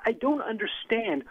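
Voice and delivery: ordinary delivery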